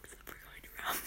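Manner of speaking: soft, breathy whisper. Real, not acted. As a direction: whisper it like a confession. Embarrassing secret slipped in.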